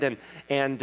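ordinary delivery

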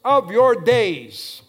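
loud, driving pulpit delivery; full-force preaching. Real, not acted. Preacher rousing the church about the next generation, full-force preaching.

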